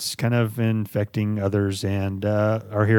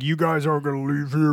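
possessed. Retold or imitated. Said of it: "starts talking in that really creepy fucking demonic voice".

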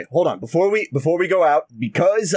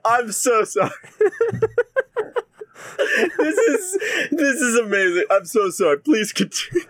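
laughing apology; 8 semitones higher than usual. Real, not acted. Player cracks up at the GM.

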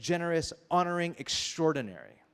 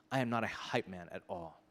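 normal talking voice

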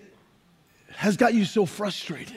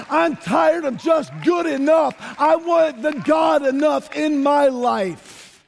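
preaching at full force; 6 semitones higher than usual. Acted. Pastor rallying the church toward a God year, shouted preaching climax. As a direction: drive the congregation up to the climax.

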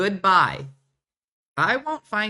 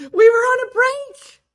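much higher, loud shouted quote; mock shout; 14 dB louder than usual. Friends joking about breaks, shouting the quote. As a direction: yell it like the famous TV line. Quoting the famous TV line.

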